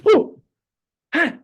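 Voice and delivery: put-on high, loud baby coo and cry; little burst of infant delight, imitated; imitated baby squeal. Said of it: "it squeals in delight".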